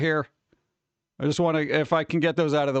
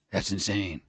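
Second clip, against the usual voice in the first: low murmur; 11 semitones lower, 6 dB quieter than usual. Real, not acted.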